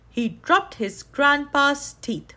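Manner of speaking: usual speaking voice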